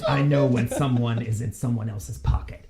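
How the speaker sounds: lightly voiced suspicious thug. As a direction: warn them like a shady tough. Krenko voiced by the GM.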